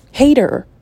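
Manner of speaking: ordinary talk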